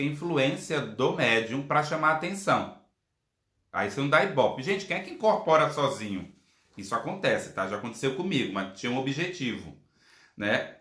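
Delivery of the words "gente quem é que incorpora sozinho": ordinary talk, nothing marked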